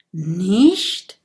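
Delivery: drawn-out rising query; surprised question. Acted. Dragon told he was not pricked, doubtful. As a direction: let it rise in doubt. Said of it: "fragt Big Bo".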